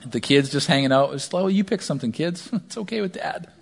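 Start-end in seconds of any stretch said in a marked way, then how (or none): 1.4-3.5 easygoing dad. Retold or imitated. Dad letting kids pick a movie.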